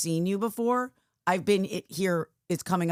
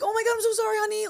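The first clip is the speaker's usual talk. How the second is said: high, airy "so sorry, honey"; breezy laughing apology; 3 dB louder, faster than usual. Retold or imitated. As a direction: toss off the apology with a light laugh, pitched way up. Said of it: "'Cause I kinda laughed".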